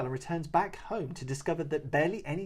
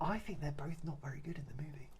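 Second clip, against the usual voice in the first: whisper; 11 dB quieter than usual. Real, not acted. Whispered aside.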